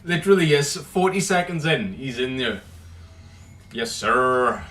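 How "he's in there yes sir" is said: normal talking voice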